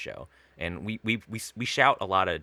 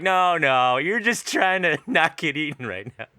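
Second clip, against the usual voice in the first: raised, loud improv protest; lightly acted animal arguing back; mock disbelief, pitched up with a laugh; doubting predator; 8 semitones higher, 6 dB louder, slower than usual. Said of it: "And they're like".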